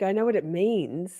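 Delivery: ordinary talk, nothing marked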